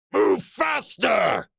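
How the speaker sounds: high, loud, slow bellow. A narrator's reading. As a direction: bellow the order at the group. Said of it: "Darvok bellowed".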